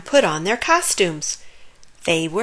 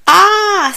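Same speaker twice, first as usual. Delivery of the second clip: squeak; 12 semitones higher, 9 dB louder than usual. A narrator's reading. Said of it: "squeaked Pip".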